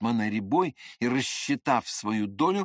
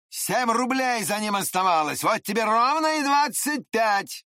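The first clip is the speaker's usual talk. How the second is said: raised, emphatic self-justifying rant; indignant rowdy man; 7 semitones higher, 4 dB louder than usual. A narrator's reading. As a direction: argue the sum loudly, rising as it builds. Andreev argues over the money.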